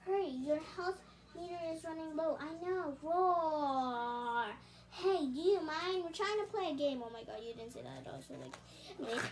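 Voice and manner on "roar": a long drawn-out monster roar. Acted. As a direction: roar like a giant frog monster. Monster Frogzilla roars.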